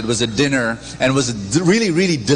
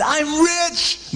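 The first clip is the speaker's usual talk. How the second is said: amazed; 13 semitones higher than usual. Acted. He was so amazed.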